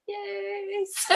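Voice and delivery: high, soft squeak of a reply; mock strained squeak. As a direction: squeeze it out high, breaking into laughter. Acts her reply mid-labour, laughing.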